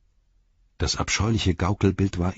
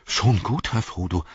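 somewhat startled. A narrator's reading. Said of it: "sagte Sam einigermaßen erschrocken".

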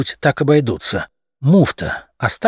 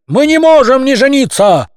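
outraged protest; 13 semitones higher, 6 dB louder than usual. A narrator's reading. Young cats outraged.

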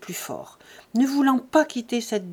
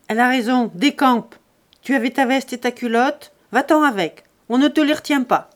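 loud, higher, shouting him away; angry dismissal; 3 semitones higher, 7 dB louder than usual. A narrator's reading. Buteau backing his wife, shouting Jean off the doorstep. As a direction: order him out with contempt.

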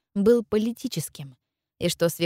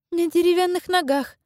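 put-on high girlish inner thought; young girl voice; 11 semitones higher than usual. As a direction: think it in a girl's voice. Narrator voicing girl's thought.

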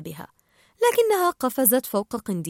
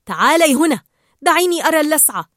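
loud, higher, hurried order; urgent concern; 5 semitones higher, 8 dB louder, faster than usual. Acted. Marlin wanting to see Dory's sting, urgently concerned. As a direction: summon firmly, then hurry on.

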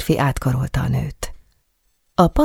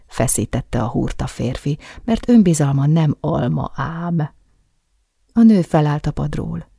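plain reading voice

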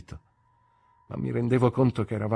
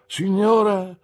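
higher, louder bow of a word; courtly Micawber; 12 semitones higher, 9 dB louder, slower than usual. A narrator's reading. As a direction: address her grandly. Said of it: "rispose mister Micawber". (Micawber, cerimonioso)

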